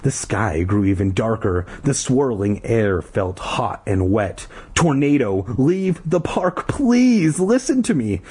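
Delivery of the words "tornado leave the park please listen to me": high, desperate warning; acted frantic megaphone plea; frantic mock scream. A narrator's reading. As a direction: beg the crowd frantically, pitch climbing. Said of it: "screaming frantically into the megaphone".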